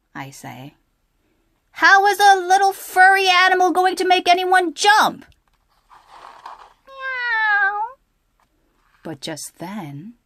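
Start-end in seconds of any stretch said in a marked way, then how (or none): 1.9-5.2 scoffing. Acted. Charlie scoffs at Lola. 6.9-7.9 high little mock meow; kitten meow. Kitten in story.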